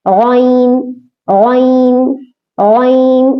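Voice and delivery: teacher sounds गाइन thick and full-mouthed; heavy Ghain. Shown as a demonstration. Teacher shows students गाइन said thick and heavy. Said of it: "एकदम मोटा पन्ना है".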